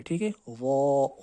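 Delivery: ordinary delivery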